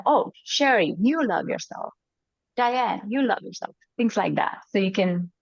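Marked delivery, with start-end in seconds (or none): 0.1-3.8 higher-pitched, as if another person is telling her; lifted second-person affirmation; someone affirming you. Shown as a demonstration. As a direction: speak to her like an admiring friend, lifted on "you". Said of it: "like somebody else is saying to you".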